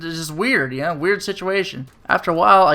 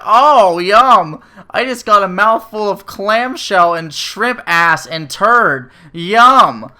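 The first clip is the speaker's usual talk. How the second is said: loud, higher mock delight; sarcastic yum; 4 semitones higher, 6 dB louder, much slower than usual. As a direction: fake relish over gross food. Shrimp with shells, sarcastic.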